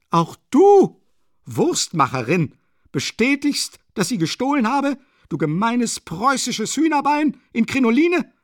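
very high, loud railing; outraged insults. A narrator's reading. Katerina abusing the landlady for backing the accusation, outraged shrieking. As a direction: shriek abuse in disbelief.

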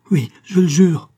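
high, loud desperate promise; frightened oath. A narrator's reading. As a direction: swear it urgently, voice jumping up. Terrified captive swearing at knifepoint.

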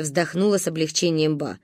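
ordinary delivery, nothing marked

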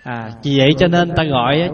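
normal talking voice

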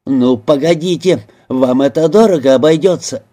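ordinary delivery

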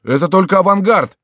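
high, loud outcry; crowd shouting. A narrator's reading. Retreating townsfolk justifying their flight, shouting high. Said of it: "кричали граждане".

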